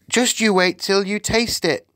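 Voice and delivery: put-on high-pitched girlish promise; excited little Sophie. Sophie promises the BFG a treat, excited.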